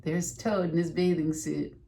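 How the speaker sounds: plain reading voice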